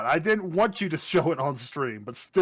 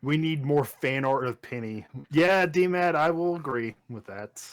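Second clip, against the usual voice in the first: normal talking voice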